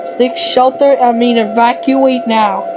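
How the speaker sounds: usual speaking voice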